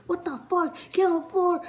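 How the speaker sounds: put-on yelling officer ordering kids down; cops yelling. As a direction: snap it out like an angry officer. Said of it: "the cops are still yelling at us".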